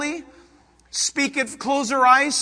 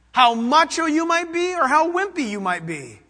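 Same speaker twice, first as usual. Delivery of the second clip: ordinary delivery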